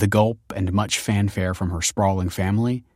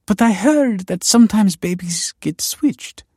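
high, slow, pleading voice; desperate suggestion; 13 semitones higher, 5 dB louder than usual. A narrator's reading. Desperate woman hinting at switched babies, pleading. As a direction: venture it pleadingly, pitched up.

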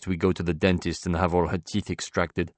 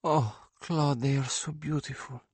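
high, softened rapture as the painter; rapturous; 8 semitones higher, 4 dB quieter than usual. A narrator's reading. Painter to Claude about her hero's paintings, rapturous. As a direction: breathe out wonder at beloved paintings, pitched up. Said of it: "become rapturous and light-filled".

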